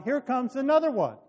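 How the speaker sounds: narrated lookout's shout, slightly restrained. A narrator's reading. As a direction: call out news from above. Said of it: "He shouted down".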